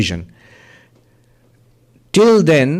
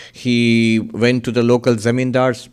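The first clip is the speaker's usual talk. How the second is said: ordinary talk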